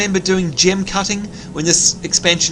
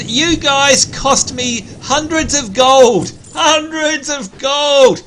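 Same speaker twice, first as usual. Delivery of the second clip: shout; 10 semitones higher, 5 dB louder, slower than usual. Real, not acted. Angry at mobs that cost him.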